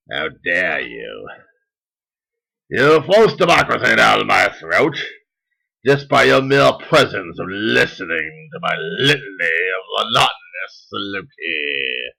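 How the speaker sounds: a hammed-up zealot decreeing to foreigners, grand and booming; pompous mock proclamation. Mock Trumplican addressing foreigners across the border, pompous and proclaiming.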